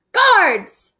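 a high, loud shout for the guards; king's order. Acted. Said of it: "the king ordered".

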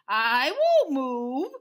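very loud, drawn-out cow refusal; Bossy Cow. Acted. Cow refuses to leave the truck, loud slow bellow. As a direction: bellow it slowly and stubbornly. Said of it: "said Bossy Cow".

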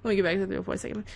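normal talking voice

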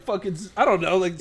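ordinary talk

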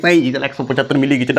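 mock student mumble. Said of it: "আস্তে আস্তে বলছে".

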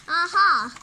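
loud, high aha of discovery; triumphant aha. Acted. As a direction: shout it as the idea lands. Sudden idea.

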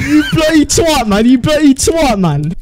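exaggerated UK lad voice; mock British accent. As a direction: put on a hammy British accent. Mock British insult game.

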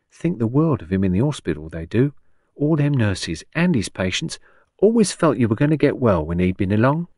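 lively, lilting Cockney woman; chirpy old Cockney patient. Acted. Patient recalls her doctor to a visitor, chirpy Cockney.

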